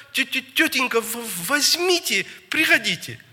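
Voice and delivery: high, pleading stammer; stuttered words pitched far up, as if through tears; tearful stutter. Retold or imitated. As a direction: imitate a crying child stammering out a plea. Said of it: "а он плачет, слёзы льются".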